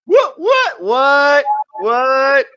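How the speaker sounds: what, what, what squealed high and loud; high squeaky what. Acted. Playful high repeated what.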